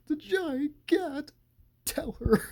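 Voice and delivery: very high, drawn-out mock plea; comic impression. Imitating Larry pleading about the giant cat, high comic wail. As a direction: imitate him in a slow, high-pitched voice. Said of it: "hilarious the way he says it".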